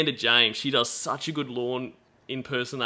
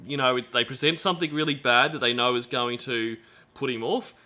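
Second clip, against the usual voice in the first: ordinary talk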